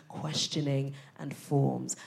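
normal talking voice

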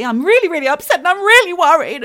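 high, loud re-enacted upset; acted distress, pouring out to the doctor; breaking down. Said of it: "And I broke down".

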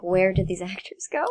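breathy whisper. Retold or imitated. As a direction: whisper it in dismay. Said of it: "I'm like".

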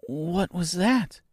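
put-on a frightened little girl asking; timid. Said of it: "she asked timidly".

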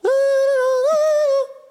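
long high singing laugh; sing-song laugh. Real, not acted. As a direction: laugh on a held high note. Laughs at the idea.

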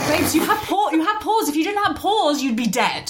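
high, laughing disbelief at someone; exasperated. Retold or imitated. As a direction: scoff at her kindly while laughing. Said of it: "And I was like".

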